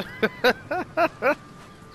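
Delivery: bursting out laughing high. Real, not acted. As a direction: let a loud high laugh out. Laugh after bad shooting.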